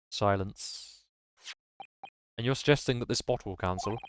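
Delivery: ordinary talk, nothing marked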